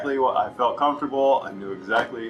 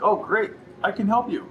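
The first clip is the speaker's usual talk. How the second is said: eager salesperson; 8 semitones higher than usual. Retold or imitated. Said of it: "you're like".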